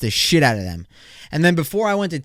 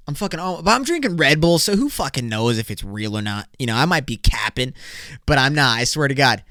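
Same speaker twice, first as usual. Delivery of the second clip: ordinary talk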